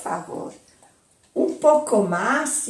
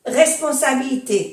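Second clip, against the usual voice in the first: normal talking voice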